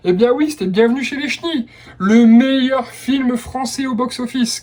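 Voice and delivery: ordinary talk, nothing marked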